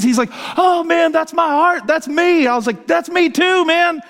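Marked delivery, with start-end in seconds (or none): 0.6-2.5 high, impassioned retold cry; overwhelmed realization. Friend recognizing himself in the verse, overwhelmed realization. 2.9-4.0 very high, fired-up retold reply; emphatic agreement. Preacher agreeing with his convicted friend, emphatic agreement.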